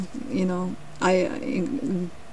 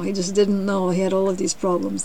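ordinary delivery, nothing marked

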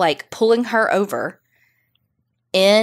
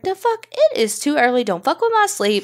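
groggy outrage; 6 semitones higher than usual. Retold or imitated. Jose woken by banging on door.

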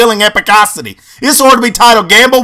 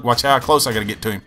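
usual speaking voice

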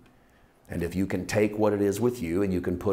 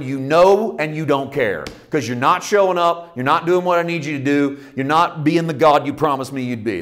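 loud, higher accusing rant at God; angry complaint; 5 semitones higher, 8 dB louder than usual. Retold or imitated. Prophet accusing God of not caring. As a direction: let him have it, God didn't show up. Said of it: "the author going".

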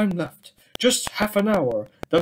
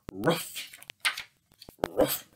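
dog bark; 9 semitones lower, 7 dB quieter than usual. Acted. Dog barks in story.

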